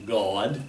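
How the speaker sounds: God said as a low "Gawd"; drawn-out Gawd. Shown as a demonstration. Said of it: "G A W D".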